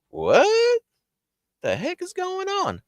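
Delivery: very high-pitched disbelief; hammed-up high panicky question; bewildered alarm. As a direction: squeal it in baffled shock. Baffled reaction to screaming, retold.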